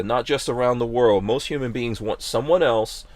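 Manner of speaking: usual speaking voice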